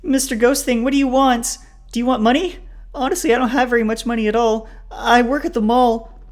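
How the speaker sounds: very high, loud hysterical pleading; panicked babbling. A narrator's reading. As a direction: plead frantically with a ghost. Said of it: "Raven keeps babbling on".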